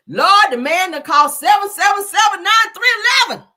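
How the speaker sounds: loud, high cry to the Lord; mock wailing shout. Telling the Lord about the call, high mock shouting. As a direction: holler it out like a hammed-up lament. Acted lament, crying out to Lord.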